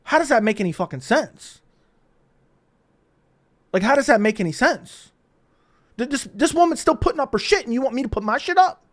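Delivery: retold high-pitched indignant disbelief; infuriated. Storyteller argues with impatient man in line, infuriated. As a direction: act out exasperation at a senseless demand. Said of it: "I got immediately infuriated".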